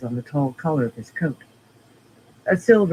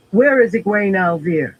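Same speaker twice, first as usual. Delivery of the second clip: demanding; 4 semitones higher, 5 dB louder than usual. A narrator's reading. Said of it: "he demanded".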